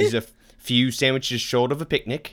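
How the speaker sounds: loosely faked Australian accent, chummy slang delivery; hammed-up, badly done Australian; awful Australian accent. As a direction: put on a bad Aussie accent. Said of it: "that's an awful Australian accent".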